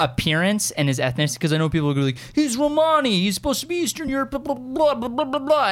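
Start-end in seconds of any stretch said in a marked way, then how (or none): 2.3-5.7 high-pitched mock fan griping; mocking complainers. Fan imitating people who object to casting, mocking. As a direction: parrot the nitpickers in a raised, dismissive voice.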